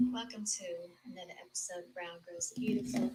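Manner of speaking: normal talking voice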